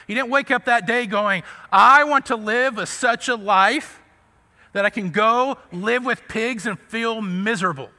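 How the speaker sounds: loud, high preaching; rising fervent preaching. Acted. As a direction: build it up with conviction. Preaching rises again to full force.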